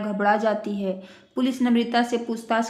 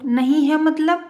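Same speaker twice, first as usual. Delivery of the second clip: alarmed, higher and louder question; shocked; 5 semitones higher, 5 dB louder than usual. Acted. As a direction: blurt it out in shock. Son hears money vanished. (हैरान होकर ज़ोर से)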